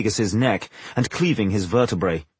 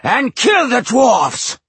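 very slow, high, loud roar; villain bellowing to his army; booming command; 13 semitones higher, 6 dB louder, much slower than usual. A narrator's reading. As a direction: boom out a drawn-out death order. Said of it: "he boomed through the darkness".

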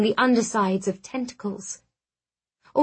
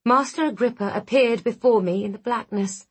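ordinary delivery

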